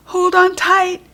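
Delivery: calling out. A narrator's reading. Tiana calling to the girls as they fly, high and loud. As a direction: call out over the magic whirl. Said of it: "she called out".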